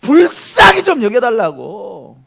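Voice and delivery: loud, slow, drawn-out plea; imitated crying out. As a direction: stretch out the plea for mercy. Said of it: "뭐라고 질렀어요?".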